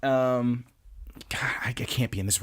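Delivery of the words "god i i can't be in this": whispered, creeped out; hushed dread about the room; real whisper. Real, not acted. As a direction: breathe it out unnerved. Creeped out by roach.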